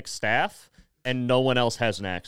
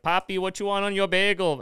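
higher Puerto Rican-accented coo, mimicked; imitating crush's accent; 7 semitones higher than usual. Customer mimicking bagel-shop crush, Puerto Rican accent. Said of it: "and she's always like".

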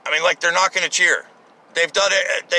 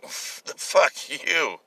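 muttered insult; 7 dB quieter, much slower than usual. Retold or imitated. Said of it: "the brides were like".

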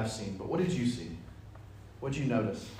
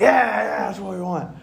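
eager agreement; 6 semitones higher, 6 dB louder than usual. Acted. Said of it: "patting each other on the back".

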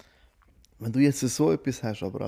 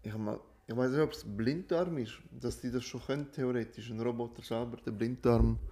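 far from the mic, much softer; off-mic, softer and distant; microphone moved away; 7 dB quieter than usual. Real, not acted. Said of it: "Falls man mich jetzt leise gehört hat, ich hab das Mikrofon weggenommen".

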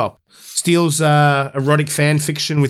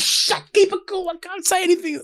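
panicked hush; 4 dB quieter than usual. Retold or imitated. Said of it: "He's like".

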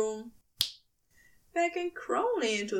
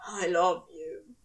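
lower, breathless; breathless confession; 5 semitones lower than usual. A narrator's reading. Demon gasping his love to the angel, lower and breathless. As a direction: gasp it out, undone. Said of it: "Crowley gasped".

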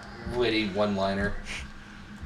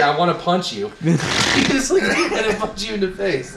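higher, laughing threat; laughing mock threat; 7 semitones higher, 3 dB louder than usual. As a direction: toss out the threat while cracking up. Quoting coworker's threat, laughing.

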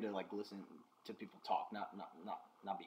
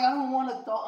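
quoted refusal; 13 semitones higher, 9 dB louder than usual. Retold or imitated. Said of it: "I'm like".

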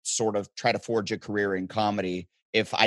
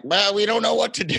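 mock official; 11 semitones higher, 5 dB louder than usual. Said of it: "So they're like".

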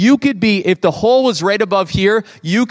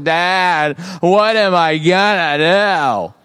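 slow, drawn-out mock kid complaint; moping kid; much slower than usual. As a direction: stretch out each word like an aimless, complaining kid. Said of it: "walking around the house like".

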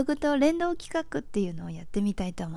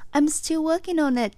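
smooth and connected; 6 semitones higher, 3 dB louder, slower than usual. Shown as a demonstration. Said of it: "まあ、ちょっと謙遜の意味で".

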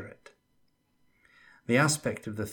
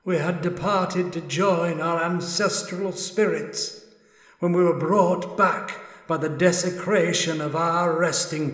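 a lonely spirit pleading its innocence; ages of suffering and loneliness; 7 semitones higher, 6 dB louder than usual. Acted. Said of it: "in a voice that held ages of suffering and loneliness".

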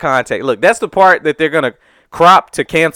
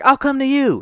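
lightly played boy, higher; a touch of an eager little kid; kid's voice; 9 semitones higher than usual. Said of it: "He sounded like he was about ten years old".

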